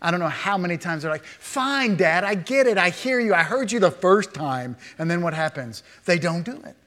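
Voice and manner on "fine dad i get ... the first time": put-on exasperated child. Child brushing off Dad's repeated telling, exasperated. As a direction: snap back like a nagged child. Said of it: "heard your children say to you".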